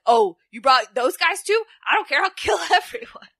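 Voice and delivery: loud, high mock rant breaking into laughter; hammed-up threat. Retold wrestler threatening everyone.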